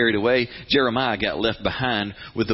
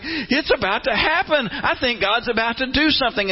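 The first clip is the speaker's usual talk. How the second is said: much higher, thrilled realization; dawning excitement; 12 semitones higher than usual. Acted. Daniel realizing the seventy years are up, excited. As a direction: voice leaping up as the hope dawns. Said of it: "he's going".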